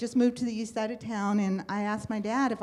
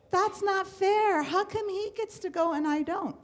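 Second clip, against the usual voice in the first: put-on childish protest; 10 semitones higher, 5 dB louder than usual. Girl protests to dad about brother's movies, high indignant whine. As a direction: whine it like a wronged little girl.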